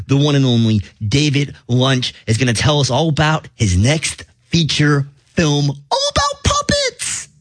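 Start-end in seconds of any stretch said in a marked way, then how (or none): 5.7-7.3 squeaky high. Acted.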